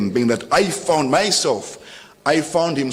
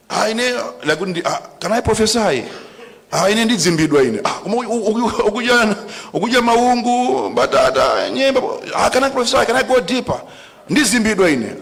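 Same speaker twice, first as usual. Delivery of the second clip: mocking hoarder voice; 9 semitones higher than usual. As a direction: ham up the hoarder's fake excuses, pitched up high. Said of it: "Now, you can't say kuti".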